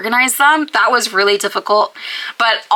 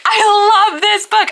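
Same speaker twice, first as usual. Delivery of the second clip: high gushing; 12 semitones higher, 4 dB louder, slower than usual. Acted. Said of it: "second take, high".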